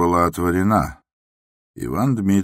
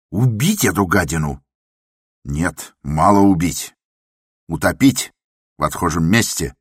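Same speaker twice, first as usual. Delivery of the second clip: raging; 2 semitones higher, 5 dB louder than usual. A narrator's reading. The patient rages at the doctor, wanting him killed. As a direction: spit out the threats in rage.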